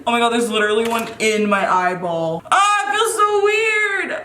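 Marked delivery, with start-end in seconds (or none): 2.5-4.1 high, loud, drawn-out wail; distressed squeal. Real, not acted. Cutter with a hair in the eyeball, high slow distressed squeal. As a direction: squeal with real discomfort.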